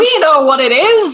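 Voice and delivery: dramatic reveal. Retold or imitated. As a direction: burst out with it like a big reveal. Kids bursting out dramatically.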